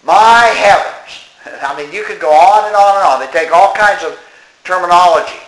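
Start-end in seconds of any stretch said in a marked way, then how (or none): 0.0-1.3 high, loud, slow "My heavens"; drawn-out mock shock; mock genteel exclamation. Mocking those who say it, a high, slow hammed-up exclamation. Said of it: "Don't say things that are tied in".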